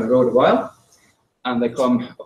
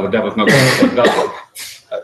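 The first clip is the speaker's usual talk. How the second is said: normal talking voice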